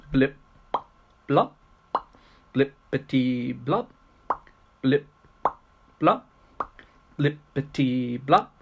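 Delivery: short isolated pops, lifting on each blop; bubbling kettle sounds. Acted. Teacher voicing the boiling kettle in a poem, popping recitation. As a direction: pop each word out with space around it.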